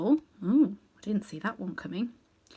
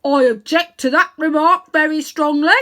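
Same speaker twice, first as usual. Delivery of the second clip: much louder, higher indignant bark; offended bulldog; 7 semitones higher, 11 dB louder than usual. Acted. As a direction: bristle harder at the insult. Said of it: "said the bulldog".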